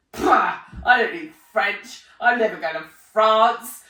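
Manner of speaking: put-on loud, scoffing teenage brush-off; mock her younger self waving French away; dismissive scoff. Schoolgirl scoffs at French class, loud and dismissive.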